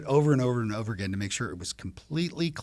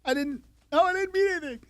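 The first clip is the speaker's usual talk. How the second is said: very high, squeaky backpedalling; flustered protest; 7 dB louder, faster than usual. Acted. Dad defending his text to his daughter, flustered high protest. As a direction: protest innocence in a high, loud voice.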